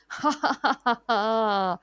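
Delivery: hearty mocking laugh; a cat's gloating guffaw; loud laugh. A narrator's reading. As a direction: laugh hard at the crying pair. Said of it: "बिल्ली खूब जोर से हंसी".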